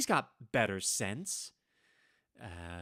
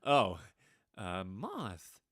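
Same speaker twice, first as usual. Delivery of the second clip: ordinary talk, nothing marked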